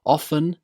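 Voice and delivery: first often as "OFF-en"; silent T in OFTEN. Shown as a demonstration. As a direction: drop the T from often. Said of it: "both pronunciations".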